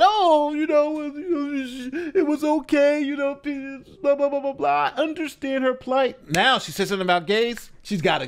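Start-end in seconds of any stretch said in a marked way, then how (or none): 0.0-6.3 high, whiny mock voice of a sympathizer; mocking woke viewer. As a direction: parody the naive defender, pitched up. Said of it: "the woke watchers who thought".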